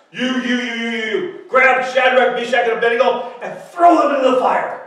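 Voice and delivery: commanding shout, building after rapid picks; calling out orders. Acted. King ordering his army guys to grab the captives, shouted command. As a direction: pick them out, then bellow the order. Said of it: "he calls out the strongest army guys".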